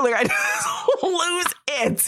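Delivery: high, slow, laughing through the words. Real, not acted. Woman admitting she loses it, high laughing. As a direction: laugh it out as you confess.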